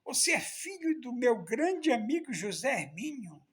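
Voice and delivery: put-on a moved old man saying it again, still amazed; repeated exclamation. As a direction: repeat it in full as if hardly believing it. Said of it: "olhou bem pra mim e disse".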